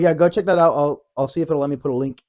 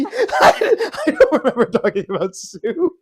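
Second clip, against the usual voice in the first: higher, louder words through laughter; laughing; 11 semitones higher, 6 dB louder, slower than usual. Real, not acted. Co-host admitting the soup talk is forgotten, laughing. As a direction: laugh while admitting total confusion.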